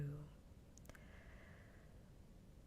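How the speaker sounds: long, quiet sigh. Real, not acted. Streamer fed up with a singer, long sigh. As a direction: breathe out slowly and wearily. Weary before a complaint.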